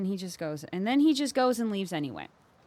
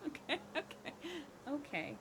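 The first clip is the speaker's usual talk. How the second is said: ordinary talk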